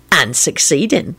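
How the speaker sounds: slow, smirking boast; mildly smug. A narrator's reading. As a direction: savour it with a smirk. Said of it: "replied with a smirk".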